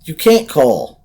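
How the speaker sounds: high loud tense answer; frightened reply. Acted. Sandra answering the boy through the door, scared.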